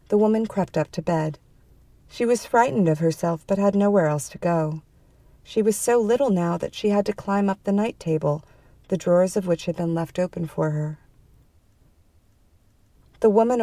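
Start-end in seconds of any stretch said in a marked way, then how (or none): none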